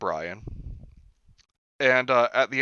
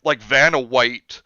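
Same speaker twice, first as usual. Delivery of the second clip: ordinary delivery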